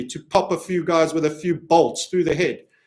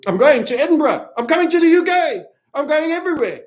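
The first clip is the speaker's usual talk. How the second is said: excited announcement; 10 semitones higher, 5 dB louder than usual. Real, not acted.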